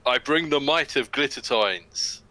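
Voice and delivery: slow, grand dwarf proclamation; a formal dwarf greeting, drawn out. Acted. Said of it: "in Dwarven".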